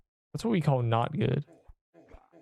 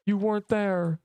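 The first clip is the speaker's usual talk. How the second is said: quoting Tony Stark; 7 semitones higher than usual. Retold or imitated. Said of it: "When Tony Stark told Cap".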